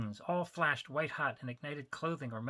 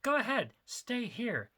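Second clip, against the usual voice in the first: insisted broadly; 9 semitones higher, 4 dB louder, slower than usual. Acted. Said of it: "he insisted broadly".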